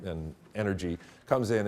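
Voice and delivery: ordinary talk, nothing marked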